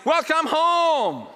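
high, very slow, drawn-out welcome; tender welcome. Acted. The Father welcoming his child home, slow tender call. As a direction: stretch it out like open arms. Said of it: "and he cries".

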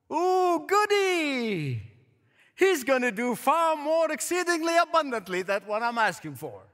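high, hammed-up gushing delight; mock glee. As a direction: squeal it like an overjoyed, expectant believer. Acts out Habakkuk's mistaken delight.

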